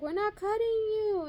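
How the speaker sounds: high, loud retold protest; mock insistent shout. Surgical team insisting to the frightened patient, mock insistent shout.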